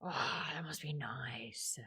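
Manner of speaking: envious whisper, slow and soft; whispered aside, a little jealous of a friend. Real, not acted. Envious aside about visualizing.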